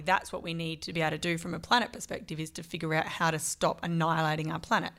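ordinary delivery

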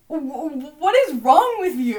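hammed-up alarm from sorority sisters; shocked friends. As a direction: stammer then blurt out the question. Friends react to her crying.

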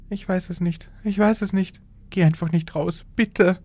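high, desperate begging; pleading. A narrator's reading. Frightened wife pleading with her husband, begging. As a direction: plead with rising panic. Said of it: "flehte sie".

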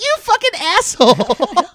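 loud, high, drawn-out comic yell; mock outraged yell. Comic retelling his reaction to being fooled, mock-furious. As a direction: explode at the prankster, half laughing. Yells at the prankster, retold.

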